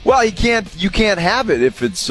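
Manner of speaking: usual speaking voice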